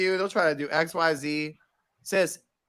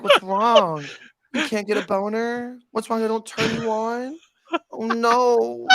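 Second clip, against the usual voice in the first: mock pity; 6 semitones higher, slower than usual. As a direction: pretend to pity the client, hammed-up. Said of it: "I was like".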